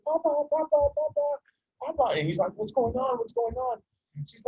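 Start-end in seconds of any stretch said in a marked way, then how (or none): 0.0-2.2 put-on high pleading little-girl voice, calling papa; high child's voice tugging at father; little girl crying papa. Small daughter crying for father. 2.5-3.8 alarmed father. Retold or imitated. Father alarmed by crying daughter.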